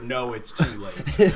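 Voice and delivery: low, slow, overdramatic groan; Aram. Retold or imitated. Quoting a vomiting child's dramatic line, low mock doom. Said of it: "Aram with his overdramatic statement".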